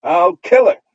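put-on high, loud little girl's threat; childish cry. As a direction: shriek it like a furious little girl. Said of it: "she would cry".

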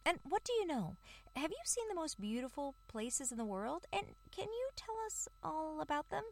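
high, eager squeaky mouse chatter; curious mouse voice. Acted. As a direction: rattle off questions like an eager mouse. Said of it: "who were full of curiosity".

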